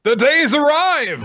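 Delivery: slow, high, booming epic announcer; epic announcement. Pretend. Hosts hyping the episode with an epic announcer voice. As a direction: proclaim it like a grand trailer.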